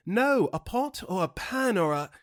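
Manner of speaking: high-pitched exasperated imitation of a Brit. Confused British customer repeating her order, mock exasperation. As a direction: flip out a little, pitch jumping up. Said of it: "like she was exasperated".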